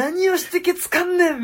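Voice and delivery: high-pitched mock Kansai scolding; fluent angry Kansai. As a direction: imitate a master losing it in fluent Kansai dialect. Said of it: "すごい流暢な関西弁で". (流暢な関西弁でキレる真似)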